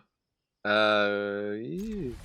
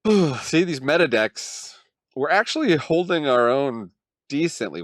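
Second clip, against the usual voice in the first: exasperated; 6 semitones higher, 9 dB louder than usual. Real, not acted. Exasperated rant about meta decks.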